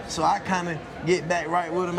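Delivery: normal talking voice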